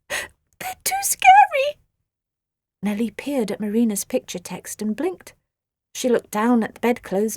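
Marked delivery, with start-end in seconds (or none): 0.1-1.8 frightened squeal. Acted. Terrified creatures squeal.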